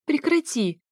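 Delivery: high screamed stop; shout. A narrator's reading. Wife screams at her husband on the phone, high. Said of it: "снова закричала она".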